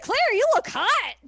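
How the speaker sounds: high, loud put-on exclamation; excited mock blurt. Podcaster voicing Milliam complimenting Claire, excited.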